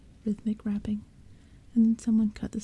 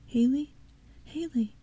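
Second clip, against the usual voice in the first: anxious calling; 4 semitones higher than usual. Acted. Shaking friend who won't answer.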